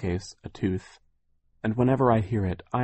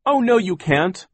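much higher, louder protest; defiant cry; 12 semitones higher, 8 dB louder than usual. Acted. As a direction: cry out to stop them. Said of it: "Klaus cried".

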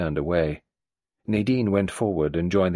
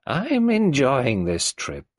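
put-on higher, creaky old matriarch voice; old tyrant mother; 7 semitones higher, 3 dB louder, slower than usual. Old Mrs Boynton greeting Nadine, in her higher old-woman voice. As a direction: play the domineering old woman.

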